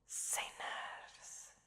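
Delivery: fully whispered, breath only; relishing whisper. Acted. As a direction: savour the word in a slow whisper. Krampus relishes his favourite prey.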